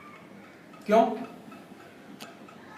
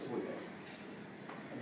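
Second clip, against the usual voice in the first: whisper; 8 semitones lower, 25 dB quieter, much slower than usual. Retold or imitated. Bank clerk reassuring, retold anecdote.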